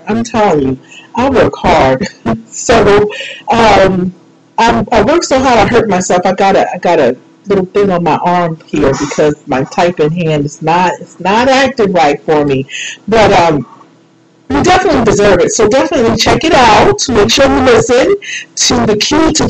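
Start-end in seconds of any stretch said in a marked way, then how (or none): none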